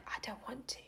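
barely audible whisper; teeny tiny voice. Retold or imitated. Woman finally refusing a man in the shower, tiny whisper. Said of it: "this teeny tiny little voice came out".

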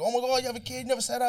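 high, loud excited gasp; surprised friend. Retold or imitated. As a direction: squeal it like a shocked friend.

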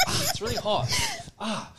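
high, soft pained yelp; mock pained cry. Boy burnt by cigarette, soft high pained yelps. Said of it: "I was like".

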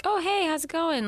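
high-pitched imitated hello; mock friendly greeting. Podcaster imitating film heroine chatting at party, high greeting voice.

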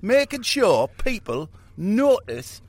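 high, loud Geordie rant; a Geordie rock star preaching about the world. Retold or imitated. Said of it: "The old Geordie".

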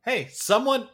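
high, loud hey; calling out. Pretend. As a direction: call out to someone.

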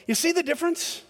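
normal talking voice

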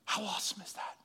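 a hushed, unvoiced whisper; whispered awe. Real, not acted. Preacher whispers his awe to the congregation. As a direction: breathe it out in wonder.

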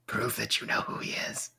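secretive whisper; interrogating whisper. Acted.

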